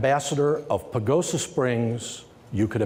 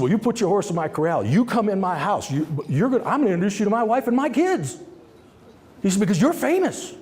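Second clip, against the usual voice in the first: eager welcome; 6 semitones higher, faster than usual. Retold or imitated. Excited host invites him in.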